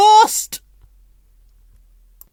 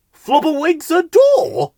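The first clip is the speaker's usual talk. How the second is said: high, loud shocked gibberish; gasped; 13 semitones higher, 6 dB louder than usual. A narrator's reading. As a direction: gasp it out in alarm. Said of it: "gasped Bill".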